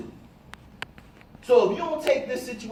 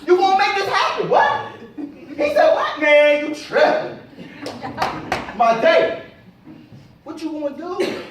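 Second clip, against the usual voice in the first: much higher, louder pumped-up self-talk; hype pep talk; 8 semitones higher, 9 dB louder than usual. Retold or imitated. Speaker shows how he pumps himself up in the mirror, loud and high. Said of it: "I'd be in the mirror going at it".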